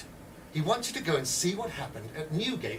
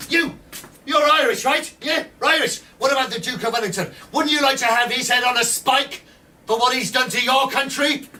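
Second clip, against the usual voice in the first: much higher and louder, egging him on; goading shout; 7 semitones higher, 8 dB louder than usual. Acted. Edwards goading an Irishman about Wellington, loud and high.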